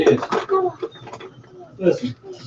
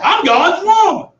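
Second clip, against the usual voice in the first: shout; 9 semitones higher, 5 dB louder than usual. Acted. Preacher shouting to the church. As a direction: cry it out to the congregation.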